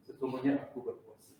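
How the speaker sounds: soft, low reminder to oneself; quiet self-restraint; calm self-reminder. Shown as a demonstration. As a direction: say it softly and low, keeping patient. Models calm reply when provoked.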